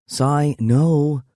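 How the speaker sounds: playful half-hearted refusal; half-hearted protest. Acted. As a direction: protest without meaning it. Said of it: "she protests half-heartedly".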